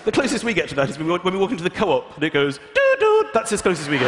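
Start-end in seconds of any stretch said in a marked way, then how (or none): 2.6-3.3 high, loud two-note electronic ding-dong; imitated door chime. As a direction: sing the shop door chime.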